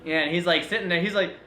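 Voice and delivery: ordinary talk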